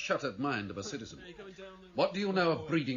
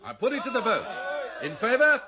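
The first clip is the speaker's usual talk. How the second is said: calling the vote; 11 semitones higher, 6 dB louder than usual. Acted. Leader calls the vote.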